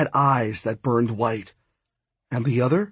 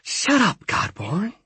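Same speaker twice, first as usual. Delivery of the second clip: high-pitched goddess cutting someone off; 6 semitones higher than usual. A narrator's reading. Ishtab silences the narrator, sharply cutting in. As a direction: cut in high, dropping on the last word. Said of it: "when she cut in".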